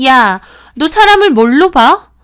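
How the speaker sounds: loud offended shout; angry shout. Acted. Friend insulted by narrator's retort, loud offended shout. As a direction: hurl it back, insulted.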